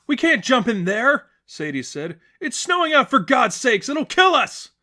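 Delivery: high, loud frantic refusal; panicked protest. Acted. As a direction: refuse in terror. Sadie protesting the jump.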